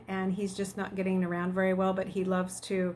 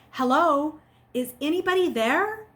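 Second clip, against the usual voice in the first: higher, louder hopeful call; anxious calling out; 7 semitones higher, 5 dB louder than usual. Acted. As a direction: call into the silence. Calling out typed question anxiously.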